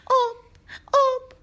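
very high, loud short calls; desperate cry. A narrator's reading. Thirsty lemon girl begging the prince for water, high cry. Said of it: "دختر بیچاره دوباره گفت".

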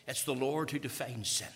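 normal talking voice

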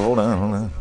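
usual speaking voice

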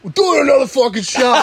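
high laughing shout as Dave; mock shout. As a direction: bellow it like a rowdy buddy. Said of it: "He's like".

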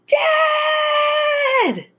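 very high, long-held scream; blood-curdling scream. A narrator's reading. Terrified girl calling for her dad, screaming. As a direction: scream it out and hold it. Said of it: "A blood-curdling scream erupted".

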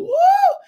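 high loud woo; praise whoop. Real, not acted. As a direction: whoop for joy. Preacher whooping.

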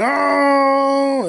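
high startled "Oh!"; high, loud mock crowd "Oh!"; crowd gasp. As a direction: react like a shocked class. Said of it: "And they're like".

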